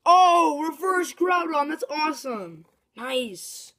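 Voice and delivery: high, loud burst of joy; thrilled by the pull; excited shout. Real, not acted. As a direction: cry out in delight. Pulls reverse Groudon.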